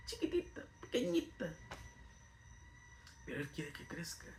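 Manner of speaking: a soft whisper for tiny faith. Real, not acted. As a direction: shrink the voice down to a whisper. Preacher describes tiny faith.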